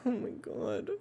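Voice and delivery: high, breathless wheezing laughter; laughing fit. Real, not acted. Player cracking up at the game, helpless laughter. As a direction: lose it completely.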